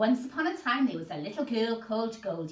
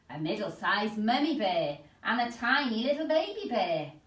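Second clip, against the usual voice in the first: usual speaking voice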